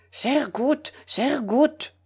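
lightly voiced critic, murmuring in German. A narrator's reading. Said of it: "murmura le critique". (critique qui murmure, voix plus aiguë)

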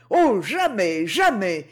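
mildly despairing, emphatic refusal; understated cry of despair. A narrator's reading. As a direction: refuse with a touch of desperation. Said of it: "répondit Proserpine au comble du désespoir".

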